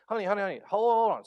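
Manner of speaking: high, loud hushing call; calling to daughter. Retold or imitated. Father to fussy daughter in back seat, loud and high. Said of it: "and I said".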